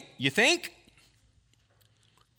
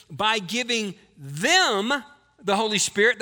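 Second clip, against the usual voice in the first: slow, louder, stress on "them"; emphatic reading; 4 dB louder, slower than usual. Shown as a demonstration. Pastor stresses the verse's key phrase for the congregation, slow and loud. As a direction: lean hard on "them" in "By giving them the Holy Spirit". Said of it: "That's the emphatic position".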